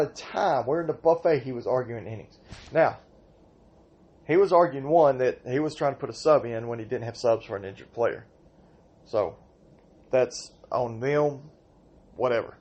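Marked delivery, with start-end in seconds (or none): none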